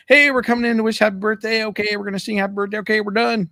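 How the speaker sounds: high-pitched, loud, rushed singsong voice; mock chirpy announcer. Mocking the rushed, underwhelming birthday bit.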